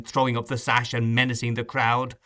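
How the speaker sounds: plain reading voice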